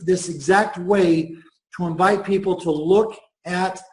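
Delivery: ordinary talk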